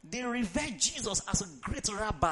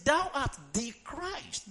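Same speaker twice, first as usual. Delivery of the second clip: drawn out, each word spaced; slow teaching emphasis on Thou art the Christ; slow emphatic quote; 5 semitones higher, slower than usual. Shown as a demonstration. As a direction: spell it out slowly for the congregation. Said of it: "That's why I ask you to say that word".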